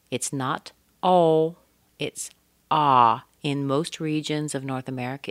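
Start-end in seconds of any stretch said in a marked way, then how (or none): 0.1-3.2 "ah" said open and loud; the O in bought as ah; ah not O. Shown as a demonstration. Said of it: "the B O U G H".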